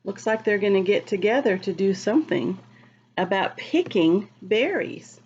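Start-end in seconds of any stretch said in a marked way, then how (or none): none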